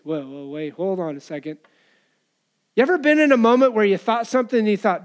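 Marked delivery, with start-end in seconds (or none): 0.0-1.6 low, soft interjection; mock hold-on aside. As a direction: halt them under your breath. Acting Jesus halting the thinkers.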